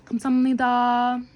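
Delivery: polite thanks. Shown as a demonstration. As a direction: thank them with a little bow. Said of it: "polite thank-you demo".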